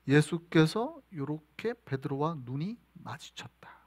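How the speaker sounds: slow whispered storytelling; hushed whisper. Real, not acted. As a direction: draw them in with a slow whisper. Dramatic moment told hushed. (숨죽인 느린 속삭임)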